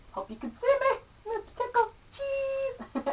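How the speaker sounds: very high, soft squeaky aside; high playful squeak to the camera; squeaky silly voice. Pretend. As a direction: squeak it out like a cartoon.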